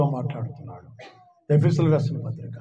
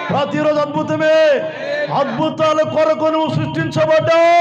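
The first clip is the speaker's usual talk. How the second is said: rising higher and louder, repeating with growing force; building sermon cry to the congregation; fervent preaching; 11 semitones higher, 4 dB louder than usual. Real, not acted. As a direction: preach it up to a ringing climax. Pastor builds to fervent climax.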